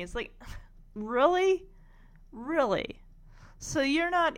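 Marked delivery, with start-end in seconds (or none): 0.9-1.7 rising, higher, louder disbelief on really; incredulous really. Real, not acted. Incredulous at Peppermint Patty's request. 2.3-3.0 Really said level and falling, unimpressed; deadpan really. Real, not acted. Repeats it, flat and falling.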